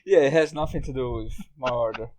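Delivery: ordinary delivery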